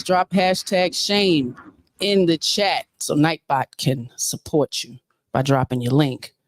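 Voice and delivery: usual speaking voice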